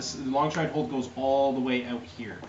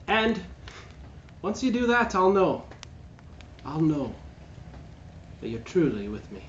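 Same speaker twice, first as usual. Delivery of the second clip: villainess sister; 6 semitones higher than usual. Acted. Sister warns brother she'll know, high and pointed. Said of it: "And she's like".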